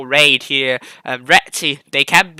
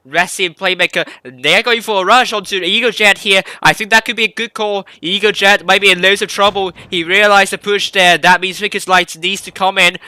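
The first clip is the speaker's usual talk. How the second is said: louder, higher fast-paced commentary; excited play-by-play; 5 semitones higher, 5 dB louder than usual. Real, not acted. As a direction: call the rush with rising excitement. Live play-by-play heating up.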